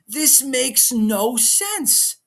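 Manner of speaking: drawn out slow and high in disbelief; incredulous. Real, not acted. Fan venting to viewers, slow incredulous complaint. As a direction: stretch each word, baffled. Ranting about McAfee reveal.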